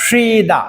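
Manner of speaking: সিদা with a long, high first syllable; model pronunciation. Shown as a demonstration. Teacher showing learners the word for rest, model pronunciation. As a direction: say সিদা as two clear parts, "shee-da". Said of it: "এটির উচ্চারণ".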